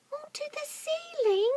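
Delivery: very high astonished squeak; small monkey doubting its dad; small monkey cry. Acted. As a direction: squeak in disbelief. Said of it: "cried one of the small monkeys".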